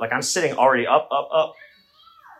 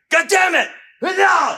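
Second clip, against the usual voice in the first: high, loud mock yell; trying to sound tough; 5 dB louder, slower than usual. Comedian acting out scaring off an intruder, high shouting. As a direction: shout to make yourself bigger. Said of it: "I was trying to sound tough".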